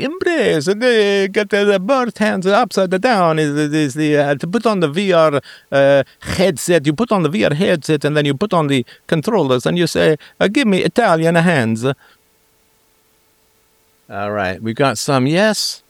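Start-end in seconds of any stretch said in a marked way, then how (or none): 0.0-11.8 high-pitched hammed-up Italian accent; cheesy Italian accent. Streamer embracing linguistic cliche, cheesy high Italian accent. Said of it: "I do the cheesiest accents".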